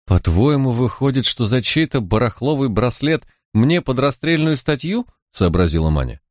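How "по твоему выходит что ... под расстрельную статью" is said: put-on higher, indignant street-girl voice; a sulky young crook, pitched up and wronged; lightly voiced defiant girl, higher than the narrator; Manya, young thief. A narrator's reading.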